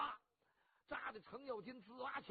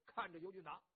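ordinary delivery

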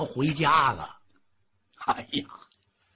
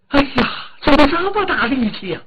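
amazed cry, higher and louder; amazed servant; 9 semitones higher, 7 dB louder than usual. Acted. Servant Li Xing cries out in amazement at the boy. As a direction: gasp at how strong the little boy is.

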